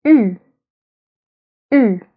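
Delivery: "U" as a tight front rounded vowel; French u sound. Shown as a demonstration. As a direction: say "U" as ee through rounded lips. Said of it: "I can think of the sound".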